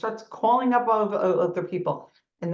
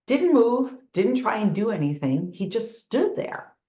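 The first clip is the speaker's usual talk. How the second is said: ordinary delivery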